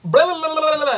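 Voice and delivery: loud, high-pitched mock babble; imitating a kid's nonstop chatter; excited chattering kid. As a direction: gabble like an excited little boy. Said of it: "he just starts talking".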